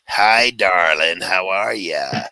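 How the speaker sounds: very slow, drawn-out imitation of a woman's voice; put-on greeting, loud at first, long vowels; ex-wife's speaking voice. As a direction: imitate her, stretching every word right out. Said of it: "her normal speaking voice is".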